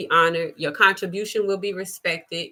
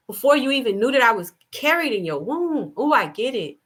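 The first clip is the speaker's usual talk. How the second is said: ordinary talk, nothing marked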